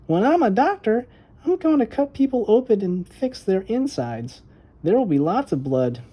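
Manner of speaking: put-on a brave girl boasting to her brother; little girl Violet. A narrator's reading. Little sister boasting she is not scared of blood, proud high voice.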